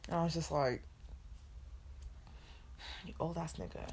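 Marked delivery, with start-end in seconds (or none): 3.0-3.9 much softer, muttered under the breath; soft disgusted aside to herself; inner thought. Retold or imitated. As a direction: say it like a private thought. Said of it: "That's all I kept thinking in my head".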